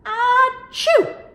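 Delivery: loud, high acted sneeze; hammed-up storybook sneeze; big sneeze. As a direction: sneeze with vegetables in your face. Lion sneezes from vegetables.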